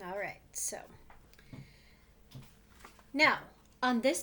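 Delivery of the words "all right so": whisper. Real, not acted. Whispered aside.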